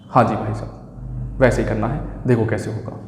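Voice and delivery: low, breathy, slowed "yes sir" to a student; a hushed, humouring "yes sir"; mock-humble reply. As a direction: answer softly, humouring the student. Teacher mock-answers imagined student.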